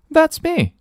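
high, bright, cheerful hello. Acted. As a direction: answer the phone brightly, like a happy girl. Nelly answering brightly.

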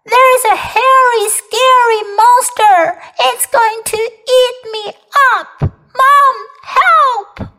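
high, loud cry for help; frightened shouting. Acted. Kipper calling Mom about a monster, frightened shouting. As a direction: call out in panic, dragging out the scary words. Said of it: "齐波大喊起来".

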